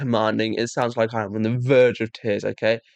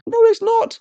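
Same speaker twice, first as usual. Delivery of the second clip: outraged no; 8 dB louder than usual. Real, not acted. Angry rebuttal.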